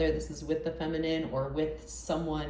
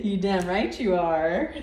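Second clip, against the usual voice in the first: admiring drawl; 4 dB louder, slower than usual. Retold or imitated. Voicing the man's admiring reply.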